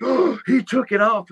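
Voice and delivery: high, gasped imitation of shocked fans; scandalized gasp, pitched high; mock shock. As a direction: imitate an amazed onlooker with a gasp. Retelling the shocked crowd's reaction.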